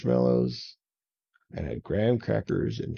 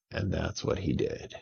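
usual speaking voice

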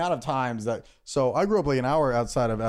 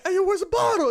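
high, loud pretend challenge; playful high-pitched shout; mock demanding call; 5 dB louder than usual. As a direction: call out accusingly, hammed up. Acted confrontation over the missing bottle.

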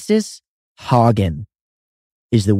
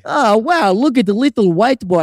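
mock Russian thug; 9 semitones higher than usual. Voicing Russian guys.

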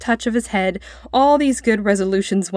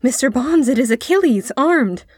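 higher, awed exclamation; thrilled boy's voice; excited announcement; 6 semitones higher, 3 dB louder than usual. A narrator's reading. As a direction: announce the hero with rising excitement. Boy excitedly announces the warrior.

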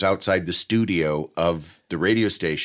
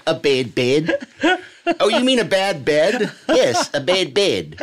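Kiwi ad voice, laughing; 8 semitones higher, 3 dB louder than usual. Retold or imitated. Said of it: "an ad on the radio".

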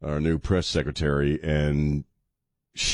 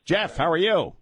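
bright caller greeting; 11 semitones higher than usual. Real, not acted.

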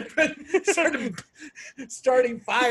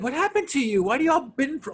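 lightly voiced, high-pitched questioning; imitated girlfriend; 8 semitones higher than usual. Girlfriend questioning her bitten boyfriend, imitated high voice. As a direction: imitate a concerned girlfriend, higher. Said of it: "go see your girlfriend, it's like".

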